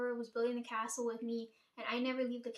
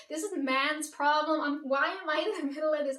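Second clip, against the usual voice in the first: mad outburst; 3 semitones higher, 7 dB louder than usual. Retold or imitated. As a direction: throw it out in frustration. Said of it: "I was so mad".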